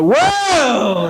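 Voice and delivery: long high held "whoa"; sudden high cry, stretched out; mock pained yelp. Man feeling hidden staple while bending, exaggerated high yelp. Acting out a painful discovery.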